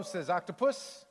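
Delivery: usual speaking voice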